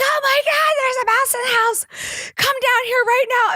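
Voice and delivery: high-pitched hysterical mock shriek; frantic, squealing alarm, hammed up; freaking-out mom. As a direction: imitate a panicked mother calling for help. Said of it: "she's freaking out".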